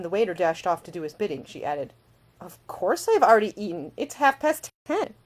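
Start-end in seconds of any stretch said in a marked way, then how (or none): 2.4-5.1 higher, louder, slowing on the time; indignant retort. A narrator's reading. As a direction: set him straight, offended.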